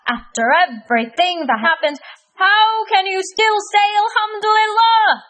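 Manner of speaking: high, loud, exasperated grump; frustrated Cranky Kareem. Acted. Cranky Kareem berating Hamdi from the bush, high frustrated squeal. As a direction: squeal it out, fed up and disbelieving. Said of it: "gave a frustrated squeal".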